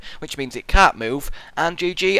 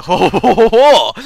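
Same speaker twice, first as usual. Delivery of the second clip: loud French-accented ho-ho-ho; mock French laugh; 5 semitones higher, 11 dB louder, slower than usual. Shown as a demonstration. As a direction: guffaw like a caricature Frenchman. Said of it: "That's how you get into a French accent".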